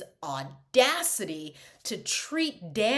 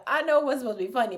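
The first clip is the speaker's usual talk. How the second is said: ordinary delivery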